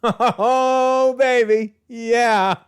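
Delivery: high, laughing whoop of delight; drawn-out, high-pitched glee; gleeful celebration. Real, not acted. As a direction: revel in it with a laugh. Host revels in the long-awaited retort.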